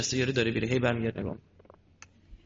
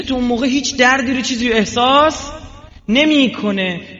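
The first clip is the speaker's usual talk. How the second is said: fiery preaching; 10 semitones higher, 8 dB louder than usual. Acted. Preacher comparing prayer to morphine for the crowd, loud fiery preaching. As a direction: preach it hard, stretch the last word.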